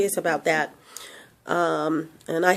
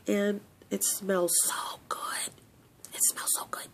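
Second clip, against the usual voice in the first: hushed whisper over the scent; 15 dB quieter than usual. Real, not acted. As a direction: breathe the words softly, savouring. Whispering about the product's smell.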